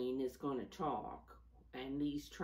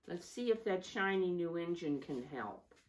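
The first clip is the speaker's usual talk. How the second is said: usual speaking voice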